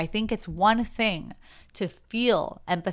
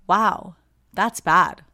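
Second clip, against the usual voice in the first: mock sympathy; 6 dB louder, much slower than usual. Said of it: "Sympathy says".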